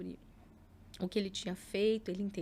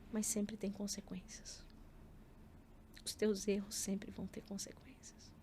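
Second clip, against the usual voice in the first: whispered warning; 2 semitones lower, 8 dB quieter than usual. Retold or imitated. Mother whispering the lesson to her son, quietly. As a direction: breathe the warning to him softly.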